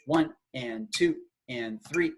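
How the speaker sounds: evenly spaced count with "and" midway; steady, square eighth-note feel; straight rhythm count. Shown as a demonstration. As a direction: count in even halves of the beat. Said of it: "just a straight".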